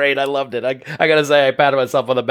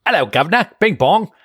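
hammed-up British accent, pitched higher; a jolly, overdone British accent; 8 semitones higher than usual. Greeting his co-host in a hammed-up British accent. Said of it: "That's two countries".

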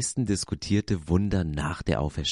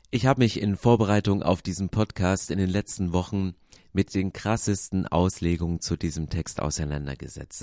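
ordinary delivery

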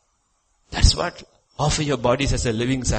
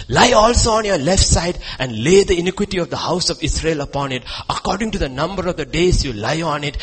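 scripture reading; 4 semitones higher, 4 dB louder than usual. Real, not acted.

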